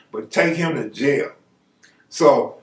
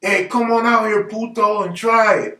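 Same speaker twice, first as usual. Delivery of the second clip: higher, challenging gardener voice; imitated Mexican gardener; 5 semitones higher than usual. Gardener answering Bobby's threat, mock challenge. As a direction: dare him in a put-on gardener's voice. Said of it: "The Mexican would say something like".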